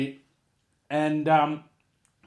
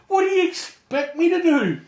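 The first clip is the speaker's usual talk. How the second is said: exasperated shout; 13 semitones higher, 6 dB louder than usual. Retold or imitated. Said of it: "I'm like".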